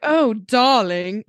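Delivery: slow, drawn-out dramatic British "darling"; hammed-up British man, stretching the words; surly British man. Imitating the narrator's surly Brit for a co-host, dramatic British accent. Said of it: "do a surly British man".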